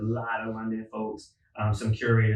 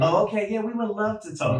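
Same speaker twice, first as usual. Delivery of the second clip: much higher, mock-eager voice; suddenly eager venue; 11 semitones higher than usual. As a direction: imitate a venue suddenly falling over itself to agree. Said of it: "now all those venues that didn't respond, was like".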